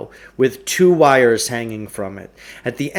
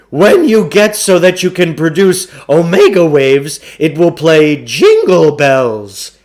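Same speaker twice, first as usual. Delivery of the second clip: higher, louder hammy huckster voice; a slick con man pitching the deal; booming carnival barker sell; pushy salesman; 5 semitones higher, 7 dB louder than usual. A narrator's reading. Klugarsh explains the meter.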